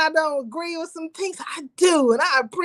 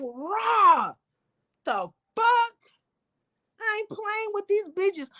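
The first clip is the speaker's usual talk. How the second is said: daddy's voice; 4 semitones higher, slower than usual. Retold or imitated. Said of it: "get into the spirit of my daddy".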